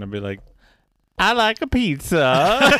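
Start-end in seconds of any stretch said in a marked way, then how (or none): 1.2-2.7 hammed-up Italian accent, much higher, louder and slow; mock Italian accent like Borat; bad Italian accent. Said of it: "do an Italian accent".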